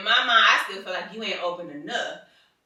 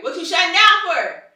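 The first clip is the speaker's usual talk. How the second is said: high and loud, pressing; loud version of the question; loud demanding question; 11 semitones higher, 12 dB louder than usual. Retold or imitated. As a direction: demand it loudly, exasperated. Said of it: "And I'm like".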